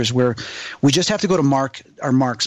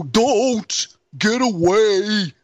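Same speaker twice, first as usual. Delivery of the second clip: raised, long-drawn ghostly call; male spirit voice; 6 semitones higher, much slower than usual. Retold or imitated. Spirit answering the investigator through the box, slow imitated male voice. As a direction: imitate a spirit, words stretched out very slowly. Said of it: "you hear this male voice go".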